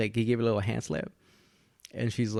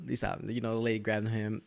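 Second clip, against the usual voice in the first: normal talking voice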